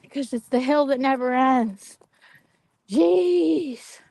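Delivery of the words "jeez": exasperated jeez. Real, not acted. Exhausted on endless hill.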